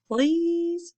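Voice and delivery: high, drawn-out pleading please; begging. Acted. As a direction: beg sweetly. Rex begging.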